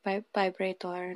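slow, soft Filipino-accented demo. Shown as a demonstration. Partner showing every letter pronounced, slow soft Filipino accent. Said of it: "They like to pronounce every, like, letter".

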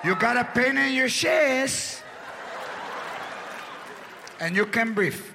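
wife gloating over sick husband; sarcastic wife. Retold or imitated. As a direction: savor his need for her. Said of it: "now she's like".

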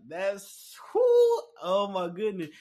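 retold shriek on spotting a character; shocked realization. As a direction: jump up in pitch like you can't believe it.

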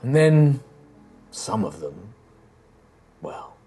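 put-on an old emperor's spirit recounting dark history; grave ancient spirit. GM voices Callus telling lore.

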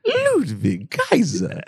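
slow, drawn-out mock announcer name; imitating ring announcer. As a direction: stretch each word like the ring announcer. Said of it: "the way that she says Ludwig Kaiser".

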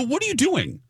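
high-pitched indignant challenge; retold outburst, voice jumping up; losing it. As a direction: demand it in high, acted disbelief. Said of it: "that's when I lost it".

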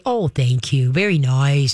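low, soothing approval; calm praise. Shown as a demonstration. Trainer showing praise that keeps dog calm, low and calm. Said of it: "You wanna keep 'em calm".

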